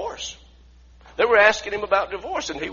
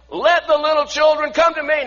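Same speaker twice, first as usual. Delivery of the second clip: loud, higher, proclaiming; loud Jesus quote; 10 semitones higher, 7 dB louder than usual. Acted. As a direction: call the children in with big volume. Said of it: "I don't think he said it with that volume".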